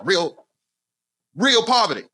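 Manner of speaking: "Real" repeated, punched high and drawn out; stress on REAL. Acted. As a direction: hit REAL harder the second time, pitch jumping up. Dwells on the word for effect.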